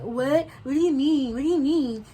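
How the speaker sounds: high, put-on innocent alarm; mock scared. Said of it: "she was looking all scared".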